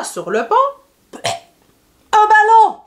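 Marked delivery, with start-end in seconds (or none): 2.1-2.8 high, loud astonished shout; surprised exclamation. Acted. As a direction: cry out at the unexpected catch. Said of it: "Il fut bien surpris".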